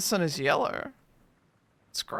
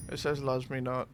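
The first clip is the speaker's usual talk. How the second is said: gloomy low mutter; 5 semitones lower, 7 dB quieter than usual. Pretend. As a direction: lower and softer, glum. Petal game alternating voices.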